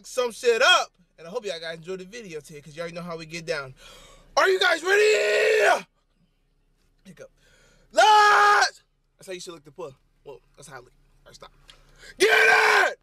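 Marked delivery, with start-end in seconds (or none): 4.4-5.8 high, drawn-out rallying yell; hype shout. Real, not acted. Vlogger rousing viewers for the workout, long high shout. Hyping viewers before the gym. 7.9-8.8 one loud, high, drawn-out holler; hype yell. Real, not acted. Vlogger firing himself up at the gym, high yell. 12.2-13.0 loud, high, stretched-out holler; hype yell. Real, not acted. Vlogger cheering on his own workout, high holler.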